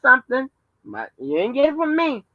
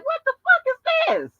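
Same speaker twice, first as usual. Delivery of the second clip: incredulous reaction. Retold or imitated. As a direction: squeal it in mock bafflement. Quoting own stunned reaction.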